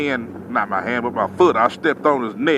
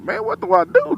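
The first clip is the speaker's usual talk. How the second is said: panicked; 13 semitones higher, 3 dB quieter than usual. Retold or imitated. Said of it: "So I'm like".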